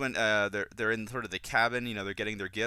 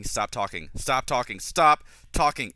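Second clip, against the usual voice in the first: exasperated plea; 6 dB louder, slower than usual. Retold or imitated. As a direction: repeat it with mounting irritation, last one drawn out. Acts out exasperated thought about Drinian.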